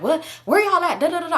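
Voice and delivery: high-pitched agitated mimicry; imitated freak-out. Boyfriend on phone demands their location, imitated freak-out. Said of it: "So he going crazy".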